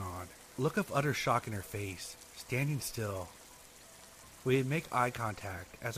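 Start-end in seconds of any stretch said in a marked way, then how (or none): none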